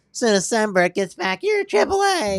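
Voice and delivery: put-on high, loud bratty teen taunt; dumb teenager voice. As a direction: sneer it like a dumb teen heckler. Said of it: "in my dumb teenager voice".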